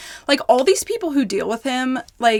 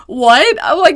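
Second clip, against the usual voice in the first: loud, high "What?"; retold disbelief, higher and louder; shocked reaction; 5 semitones higher, 8 dB louder than usual. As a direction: burst out in disbelief. Reacting to news John's running.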